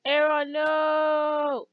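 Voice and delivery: long dismayed cry of "no"; drawn-out wail. Real, not acted. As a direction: stretch it out in mock-tragic dismay. Dismay as Aron goes down.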